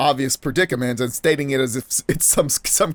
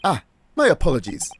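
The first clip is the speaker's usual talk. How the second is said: hammed-up British gentleman apology; amateur British accent, courtly; terrible British accent; 5 semitones higher than usual. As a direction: apologize grandly in a shaky-quality British accent. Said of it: "I have a terrible British accent".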